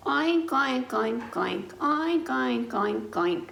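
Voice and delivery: playful oinking, high then falling; mock pig oinks. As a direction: oink like a happy pig. Pig noises in game.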